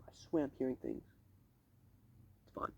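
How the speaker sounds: hushed, half-voiced muttering to oneself; uneasy whisper. Real, not acted. Vlogger alone by the lake hearing noises, uneasy whisper. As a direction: drop to a soft whisper.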